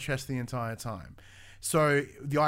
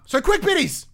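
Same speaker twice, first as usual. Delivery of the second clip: sudden high-pitched yell; abrupt excited holler, much louder; yelling into the mic; 10 dB louder than usual. Real, not acted. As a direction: blurt it out loud and high into the microphone. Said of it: "as I yell into the microphone".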